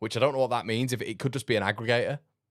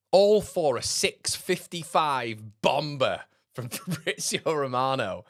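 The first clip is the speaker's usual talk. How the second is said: mock drama; 6 semitones higher, slower than usual. Mocking tweet hype.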